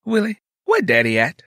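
lightly voiced sister asking sharply upward; questioning sister. A narrator's reading. Sister asks her brother where Daddy is, questioning.

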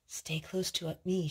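ordinary delivery, nothing marked

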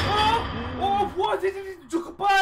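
high, loud alarm; startled shout. Real, not acted. Gamer attacked in front of his friend, high startled yelling. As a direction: cry out in shocked disbelief.

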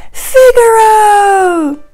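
loud, high, long-drawn name; winner announcement. A narrator's reading. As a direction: shout the winner out like a contest host.

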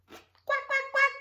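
high, loud quick quacks; duck quack. A narrator's reading. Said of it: "called the duck".

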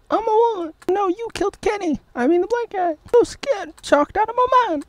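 falsetto movie character; hammed-up high fake dub; high silly dub voice. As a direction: squeak the lines like a cartoon. Long falsetto dubbing.

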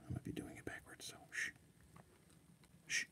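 breathy hushed secret; whisper. Real, not acted. Confessing to viewers he might be doing it backwards, whispering. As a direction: let it drop to a conspiratorial whisper.

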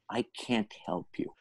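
soft, breathy, barely voiced; hushed verdict. Speech coach to the student, hushed blunt verdict. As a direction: let it fall quietly, almost whispered.

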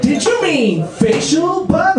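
high, slow, condescending query; haughty. Acted. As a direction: correct them slowly from a high horse. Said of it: "Google on its high horse".

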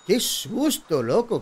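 loud, rattled after a scare; shaken exclamation. Real, not acted. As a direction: still shaken from the fright. Tras el susto del juego. (exclamación de susto)

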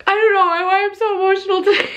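high, rushed, tearful voice; near tears. Real, not acted. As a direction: voice rising as real tears take over. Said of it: "on the verge of freaking tears".

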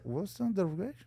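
half-swallowed muttered English; mumbling quietly so the officer won't hear; mock mumbled English. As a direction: mumble it softly so she can't hear.

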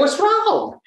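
high-pitched, bewildered Southern twang; puzzled Southern-accented protest. Retold or imitated. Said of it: "Your accent".

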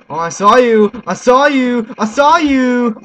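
loud, high gloating shouts; triumphant yelling. Real, not acted. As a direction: yell it in the enemy's face. Spots enemy, yells.

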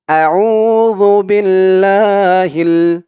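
আউযু and Billah chanted slowly; drawn-out chant for repeating; slow recitation. Shown as a demonstration. Teacher leads students in slow chant. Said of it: "তো আমার সাথে বলুন".

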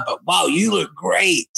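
high, stretched-out show of delight; gushing praise. Retold or imitated. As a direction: lay the compliment on thick, slowly. Repeats his gushing praise to subject.

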